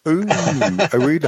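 slow drawn-out teasing ooh; mock impressed, drawn out. Real, not acted. Teasing the read along.

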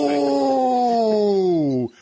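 high, long wince of an "ooh"; long falling ooh at a tasteless joke; drawn-out shocked "ooh". Real, not acted. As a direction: start high and slide slowly down.

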